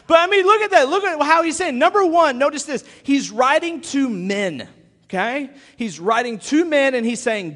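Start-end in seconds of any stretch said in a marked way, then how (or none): 0.1-5.5 excited. Real, not acted. Excited aftermath of impression.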